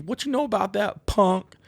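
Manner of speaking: high mocking lyric line; sing-speak the lyric high; quoted lyric. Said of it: "instead of them saying".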